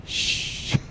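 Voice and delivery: soft unvoiced shush; playful shush. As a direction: hush everyone jokingly. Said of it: "It's all so quiet".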